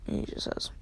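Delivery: usual speaking voice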